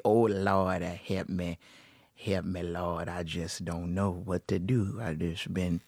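put-on soft low Southern old lady plea, slowed down; Southern accent. Shown as a demonstration. Host demonstrating her joking Southern voice, low and soft. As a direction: sigh it out to the Lord in a Southern accent. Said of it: "I talk like this every other second".

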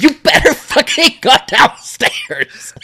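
high, slow, laughing shout; yelling at the screen. Retold or imitated. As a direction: shout a warning at the movie, drawn out. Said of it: "And I'm like, I'm like".

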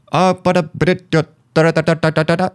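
high gibberish da-da-da; mock fast Spanish. Cashier answering in Spanish, high mock gibberish. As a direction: imitate rapid speech you can't understand.

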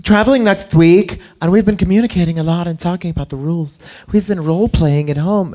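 put-on woman's voice, lifted high; girl talking. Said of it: "so I think it's the girl talking".

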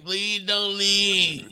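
slow, soft, drawn-out plea; mock begging. Down on his knees begging his partner, slow soft plea. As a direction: beg on your knees, slowly and softly. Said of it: "I was down on my knees".

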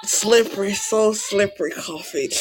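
a lightly voiced creepy seducer; creepy sultry voice. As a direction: purr it out like a creepy ad. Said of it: "That shit sounds so disturbing".

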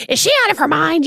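imitating husband's high-pitched disbelief; incredulous. Husband reacts while driving to doctor's offer, incredulous. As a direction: throw it up high as a retold outraged question.

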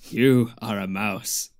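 slow, grave pronouncement; drawn-out solemn voice; mock solemn reveal. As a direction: tell him his fate gravely.

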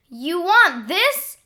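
high, slow defiant yell. Acted. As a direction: shove it at him with a shout. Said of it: "I yelled".

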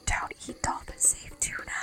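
hushed mock slogan, unvoiced; whisper. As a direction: whisper it like a secret campaign. Mock dolphin protest whisper.

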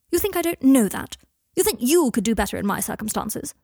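loud, indignant retort starting high; angry outburst. Acted. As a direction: explode at the accusation. Malfoy slams table, retorts.

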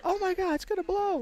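high frantic mock woman's shriek; panicked lady. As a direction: squeal it like the scared lady outside. Repeats the lady's panic.

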